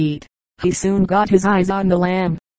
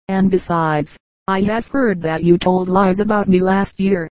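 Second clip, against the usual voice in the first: usual speaking voice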